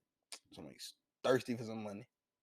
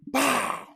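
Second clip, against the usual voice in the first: loud high bang noise; mock gunshot; 13 semitones higher, 7 dB louder than usual. Real, not acted. Recreating the gunshot in the recap, loud mock bang. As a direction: blurt the gunshot. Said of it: "gunshot sound".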